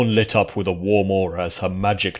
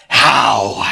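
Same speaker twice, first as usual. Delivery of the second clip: loud snarled shout, higher; creature snarl; 3 semitones higher, 8 dB louder than usual. A narrator's reading. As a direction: spit it out like a cornered beast. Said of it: "the creature snarled".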